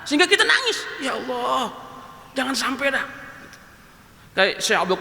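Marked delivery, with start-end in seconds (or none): 1.0-3.1 soft plea. Acted. Fearful plea to God.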